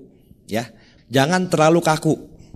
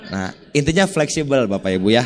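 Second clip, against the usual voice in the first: usual speaking voice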